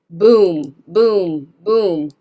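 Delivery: laute, tiefe Boom-Rufe; "boom" laut und dröhnend ausgerufen; booming demo. Shown as a demonstration. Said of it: "What do you think boom means".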